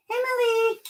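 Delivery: high sing-song pet voice, coaxing. Real, not acted. Owner on stream calling his dog over, high coaxing baby talk. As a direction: coo up high like calling a puppy.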